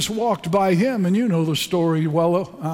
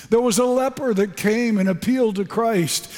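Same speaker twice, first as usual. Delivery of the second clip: normal talking voice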